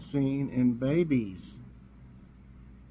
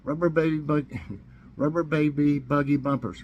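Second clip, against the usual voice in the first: ordinary delivery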